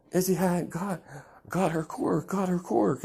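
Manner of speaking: put-on high-pitched kid bursting with news; excited announcement. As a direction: blurt out big news like an excited child. Excited child tells mother the news.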